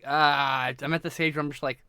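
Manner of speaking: ordinary talk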